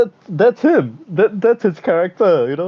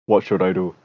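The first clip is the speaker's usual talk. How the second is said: lower, earnest mock prime minister; imitating the prime minister; 9 semitones lower than usual. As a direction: voice him low and serious, lightly acted. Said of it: "he goes to his team like".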